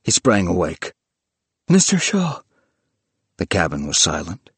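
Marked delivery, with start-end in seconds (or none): 1.7-2.4 high cry, short of a full scream; alarmed cry. Acted. Willis wakes from nightmare calling for Mister Shaw, alarmed cry. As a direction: call out the name in alarm.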